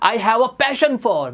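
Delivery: stretched very slowly, each word apart; slow model phrase. Shown as a demonstration. Teacher modelling a new phrase for students, very slow. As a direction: draw the phrase out for the class. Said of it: "आप बोल सकते हो".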